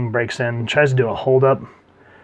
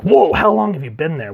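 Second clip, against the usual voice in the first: higher, louder double take; startled whoa, pitched up; surprised; 6 semitones higher, 6 dB louder than usual. Retold or imitated. Joker suddenly noticing Six beside him, surprised.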